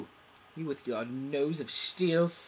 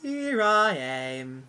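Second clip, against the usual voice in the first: slow dramatic entrance; 3 semitones higher, 5 dB louder, much slower than usual. Acted.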